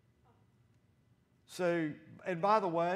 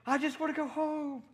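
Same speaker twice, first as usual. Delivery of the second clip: put-on high, loud whiny child voice; imitated boy's high whine; boy screaming; 14 semitones higher, 6 dB louder than usual. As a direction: wail it like a scared little boy. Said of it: "He's screaming".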